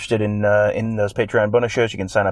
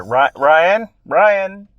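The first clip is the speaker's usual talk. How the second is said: higher, rising mock call for help; 9 semitones higher, 5 dB louder, much slower than usual. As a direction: call the name through a locked door, pitch climbing. Acting locked-in coach calling for help.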